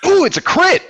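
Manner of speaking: high thrilled squeal over the crit; sudden burst of delight, way up high; excited. Real, not acted. Player rolls a critical hit.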